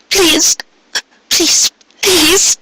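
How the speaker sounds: very high, desperate begging mare; begging aloud. A narrator's reading. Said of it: "she begged aloud".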